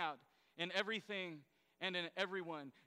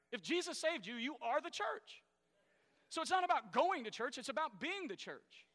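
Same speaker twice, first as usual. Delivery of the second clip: higher, louder preaching build; preaching at full force; 7 semitones higher, 4 dB louder than usual. Acted. Pastor stirs the congregation about God's promises, preaching hard. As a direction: drive each promise home to the crowd. Climax of point.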